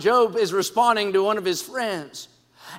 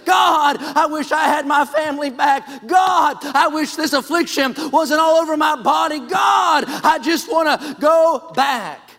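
anguished crying out; 4 semitones higher than usual. Acted. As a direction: pour out your soul, shouting each plea. Job pouring out his soul.

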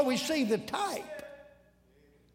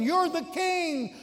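ordinary talk, nothing marked

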